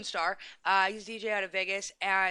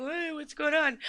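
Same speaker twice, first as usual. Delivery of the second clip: high-pitched imitation of a surprised caller; J D Nero; 7 semitones higher, slower than usual. Host quoting her guest, high imitated voice. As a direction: pipe up higher, imitating someone checking in. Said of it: "I got J D Nero going".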